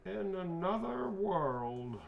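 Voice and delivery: high, very drawn-out catchphrase; character quote. Acted. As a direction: stretch each word in a high-pitched voice. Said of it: "he's gonna say the quote for you".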